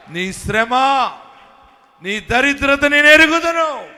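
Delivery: rising to a loud proclamation; booming divine promise. Acted. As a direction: thunder God's words over the congregation. Preacher voicing God's promise.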